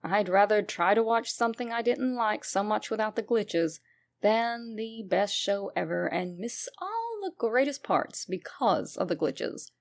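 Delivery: ordinary delivery, nothing marked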